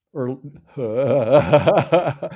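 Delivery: words broken by a loud laugh. Real, not acted. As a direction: laugh through it, drawn out. Laughing while recalling Bruce.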